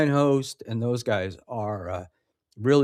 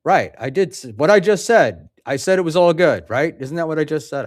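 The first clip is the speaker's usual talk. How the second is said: louder, higher self-justifying protest; indignant insistence; 4 semitones higher, 6 dB louder, faster than usual. Real, not acted. Defending his own earlier words to a doubter, indignant insistence. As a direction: insist on it, pitch jumping up.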